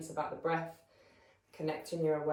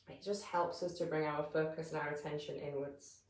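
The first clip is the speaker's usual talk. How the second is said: calm, measured meditation voice with long pauses; guided meditation; 7 dB quieter than usual. Real, not acted. Yoga teacher settling listeners into breath awareness, calm guided meditation. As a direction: guide them gently into stillness, leaving space between phrases.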